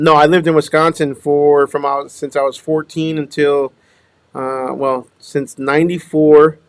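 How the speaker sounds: ordinary talk, nothing marked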